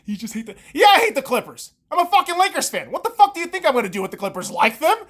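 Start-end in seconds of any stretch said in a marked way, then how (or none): none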